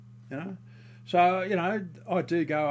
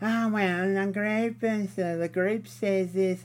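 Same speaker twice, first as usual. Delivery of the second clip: put-on higher, slower snooty old lady voice; mock the old mole in a prim, higher voice; mocking old woman; 5 semitones higher, slower than usual. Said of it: "bloody geriatric fucking".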